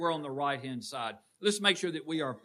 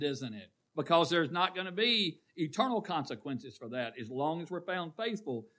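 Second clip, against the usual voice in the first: ordinary delivery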